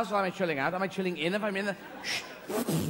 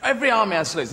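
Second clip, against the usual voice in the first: usual speaking voice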